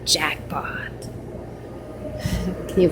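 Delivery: hushed, voiceless whisper with a giggle; whispered jackpot. Real, not acted. Vlogger whispering over free food found in the fridge. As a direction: whisper it so nobody overhears.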